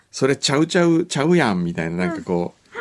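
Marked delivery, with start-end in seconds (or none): 0.1-1.6 mock Kansai-dialect drill, pitch lifted then falling. Acting out the couple's Kansai dialect training, in Kansai dialect. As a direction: practise Kansai dialect with a lilt. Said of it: "関西弁の。".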